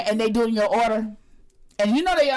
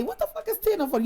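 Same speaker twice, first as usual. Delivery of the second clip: incredulous; 10 semitones higher than usual. Retold or imitated.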